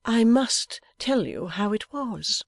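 high, slow, airy insistence. A narrator's reading. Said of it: "said the airy Missus Lammle".

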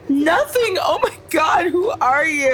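high startled shout. Acted. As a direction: jump at a stranger's entrance. Startled by newcomer.

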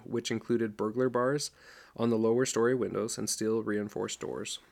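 plain reading voice

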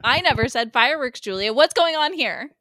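loud, high, alarmed shout; hammed-up panicked outburst; acted confrontation. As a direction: demand it at full volume, pitch climbing. Acted confrontation over fireworks.

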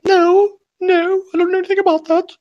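very high, loud falsetto; mock child's voice. Playing a child answering the police, in mock falsetto. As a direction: squeak it out as a feigned innocent kid.

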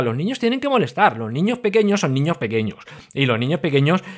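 normal talking voice

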